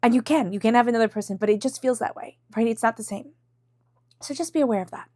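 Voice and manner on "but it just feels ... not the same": usual speaking voice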